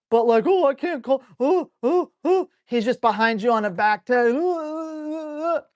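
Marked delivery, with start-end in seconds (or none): 0.5-2.5 high, fretful dog voice; high whimpering "oh"s; whining dog. Retold or imitated. Voicing the dog unable to settle. 4.1-5.6 high, soft whimper; drawn-out high dog whine; whining dog. Retold or imitated. Imitating the tied dog whining.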